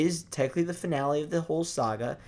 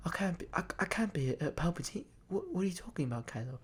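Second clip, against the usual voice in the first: small, high, much softer voice; hushed disbelief; 4 semitones higher, 10 dB quieter, faster than usual. Retold or imitated. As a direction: imitate stunned denial, barely audible. Acting out Rey's stunned disbelief.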